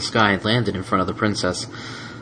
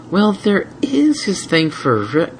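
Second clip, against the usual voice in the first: teasing Twilight; 10 semitones higher, slower than usual. Acted.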